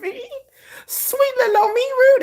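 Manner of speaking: very high, loud feigned disbelief; mock innocent offence. Sister answers brothers calling her rude, mock offended. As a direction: play the wronged innocent, high-pitched.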